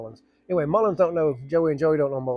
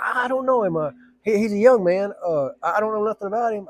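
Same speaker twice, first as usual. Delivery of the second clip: higher brushing-off voice; dismissive candidate; 7 semitones higher, 3 dB louder than usual. Retold or imitated. Candidate dismisses his rival, lightly voiced. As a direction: wave him off.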